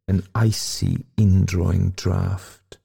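plain reading voice

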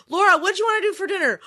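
quick, high-pitched call to another room; yelling across rooms. Retold or imitated. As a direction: call out to someone in the next room. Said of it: "I'll yell in the other room".

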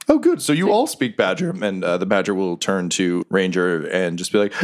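ordinary talk, nothing marked

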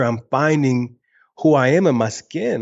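ordinary talk

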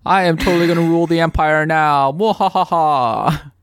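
lightly voiced gloating villain; mad scientist. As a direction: cackle like a cartoon villain at the end. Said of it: "if Darth Vader threw the Emperor".

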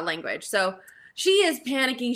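ordinary talk, nothing marked

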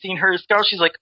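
usual speaking voice

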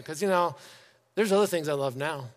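ordinary delivery

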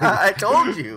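slow, high gloating told you so. Real, not acted. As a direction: gloat over being right. Gloating after being proven right.

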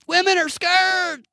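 very high, drawn-out heckler impression; mocking imitation. Host parrots the slogan after twelve hours' prep, mocking imitation. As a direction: wail the slogan slowly, high, in mockery. Mocks the shouted slogan again.

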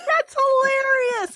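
very high, stretched-out shout of delight; tickled dad imitation. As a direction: whoop it slowly as amused dad. Imitating dad's amusement.

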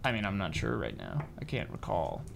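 soft, low Kansas accent. Shown as a demonstration. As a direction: try a quiet Southern Kansas accent. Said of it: "Say how they sound like".

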